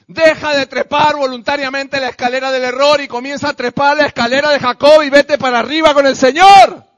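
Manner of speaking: builds from firm to full shout; rising exhortation. Real, not acted. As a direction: climb to a roaring call. Preacher exhorts to climb higher.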